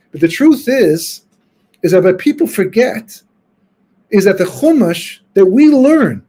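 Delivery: ordinary talk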